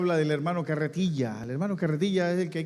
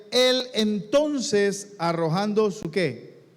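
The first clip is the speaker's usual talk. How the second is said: higher, slow emphatic preaching; impassioned preaching; 5 semitones higher, slower than usual. Acted. Pastor telling Bartimeo's story, high slow preaching. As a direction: drive the story home.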